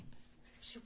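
ordinary delivery

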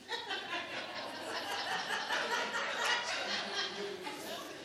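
long unvoiced laugh on a high pitch; breathy laugh. Real, not acted. The preacher cracks up at her ticklish knees, in a silent laugh. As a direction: laugh helplessly with no voice.

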